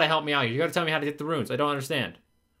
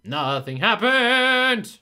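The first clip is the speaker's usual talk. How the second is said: slow, stretched, louder complaint; exasperated gamer whine; drawn-out whine; 4 semitones higher, 5 dB louder, much slower than usual. Real, not acted. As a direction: moan it out in disbelief. Horn fails to work.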